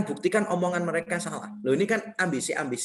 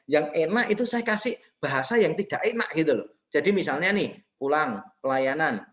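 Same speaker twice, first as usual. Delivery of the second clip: ordinary talk, nothing marked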